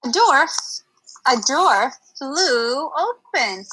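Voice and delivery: usual speaking voice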